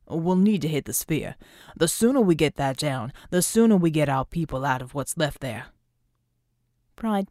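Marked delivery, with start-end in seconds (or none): 0.1-5.7 lightly lowered, gallant veteran voice; a hint of a gracious old soldier; courtly older man. Veteran hero compliments Vicky, gentlemanly and lower.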